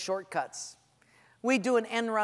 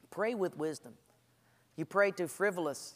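normal talking voice

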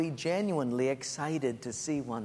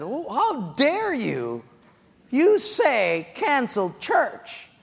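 much higher, slow, offended outrage; hammed-up offence at the suggestion; mock indignation; 9 semitones higher, 6 dB louder, much slower than usual. Pastor indignant at advice.

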